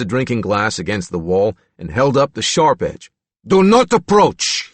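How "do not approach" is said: loud, slow, higher warning. A narrator's reading. Reagan warns the attendants holding a broken glass. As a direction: hold them back with each word. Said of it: "he warned them".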